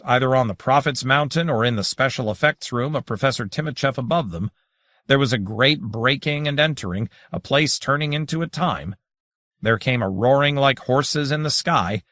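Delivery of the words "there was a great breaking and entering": ordinary delivery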